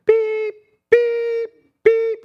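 high, loud electronic beeping; mock refrigerator door alarm beeping; imitated fridge beep. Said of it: "it goes".

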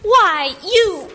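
loud, high sputter of outrage; outraged outburst. Acted. Sister taunted about breakup, loud outraged outburst. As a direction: burst out as if about to lunge.

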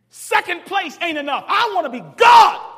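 loud, high preacher's cry; shouted sermon climax; preaching at full force. Acted. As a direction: punch 'God' with a shout. Voicing Satan's ambition loudly.

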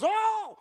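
high-pitched alarmed "oh"; hammed-up high yelp; startled donkey cry. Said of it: "Donkey goes".

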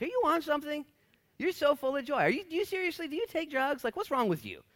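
hammed-up baffled voice, pitched well up; mock baffled stranger. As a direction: play the puzzled onlooker asking if he's on drugs. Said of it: "Do people often ask you if you're high?".

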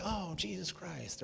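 high, soft, drawn-out mock swearing; mock exclamation. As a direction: imitate an exasperated oath, softly and slowly. Mimicking casual swearing by the name.

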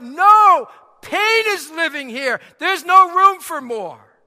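loud, high cry of refusal; poem's speaker crying out to the knocking guest, loud. A narrator's reading. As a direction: shout the door shut on the visitor. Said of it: "I cried".

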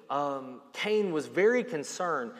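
ordinary delivery

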